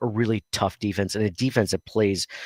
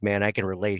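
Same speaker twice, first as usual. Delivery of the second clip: ordinary talk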